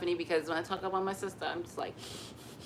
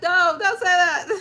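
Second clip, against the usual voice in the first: much higher, louder hushing; flustered mom, higher and louder; mock panicked scold; 11 semitones higher, 10 dB louder than usual. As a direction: shush him in a fluster.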